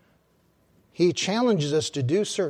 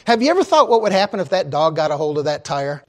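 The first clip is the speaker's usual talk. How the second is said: ordinary delivery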